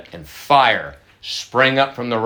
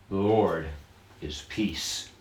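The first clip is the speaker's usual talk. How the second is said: soft solemn intoning; 5 semitones lower, 10 dB quieter, slower than usual. Shown as a demonstration. Pastor softly intoning the name to his congregation. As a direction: lower and softer, "peace" nearly hushed.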